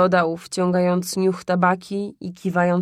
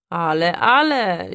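powoli, przymilnie rozbawiony; przeciągnij to słodko i kpiąco; słodko ze śmiechem; 4 dB louder, slower than usual. Acted. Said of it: "śmiał się słodko pan Łukasz". (kupiec słodko kpi z ojca)

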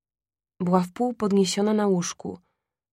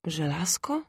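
hushed, whispered question, rising; unsure question; 2 semitones lower, 7 dB quieter than usual. A narrator's reading. Son unsure he heard his mother, hushed and hesitant. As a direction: ask it softly, doubting your ears. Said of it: "powtórzyłem niepewny, czy dobrze słyszę".

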